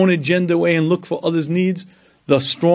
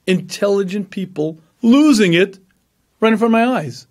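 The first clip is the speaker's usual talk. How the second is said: ordinary talk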